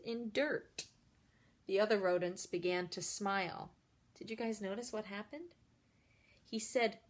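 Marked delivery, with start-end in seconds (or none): none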